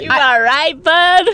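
high, loud mocking mimicry; cringey imitation. Mocking a friend's cringe phrase, exaggerated imitation. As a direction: parrot a friend's chummy check-in, overblown. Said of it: "That's so cringe".

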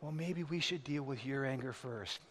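very soft, low imitated wife; hushed, careful counsel, acting the wife; gentle suggestion. Wife gently counsels her angry husband, in a soft low voice.

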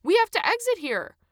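high, loud, urgent protest; yelling back. A narrator's reading. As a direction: shout that you must get off. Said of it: "Adam yelled back".

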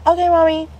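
put-on high little-kid voice; obedient child. Daughter gives in to mom, childlike.

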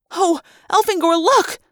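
high-pitched cry of dismay; horrified alarm. A narrator's reading. Girl horrified as fighter is attacked.

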